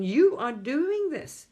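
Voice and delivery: emphatic telling; insistent. Retold or imitated. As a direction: spell it out firmly to someone stubborn. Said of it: "You're trying to tell them".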